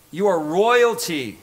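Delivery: ordinary talk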